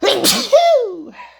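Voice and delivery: loud, high-pitched sneeze; big sneeze. Real, not acted. Reader warns viewers she is about to sneeze, big sneeze. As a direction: let out a huge squealing sneeze. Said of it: "I'm gonna sneeze".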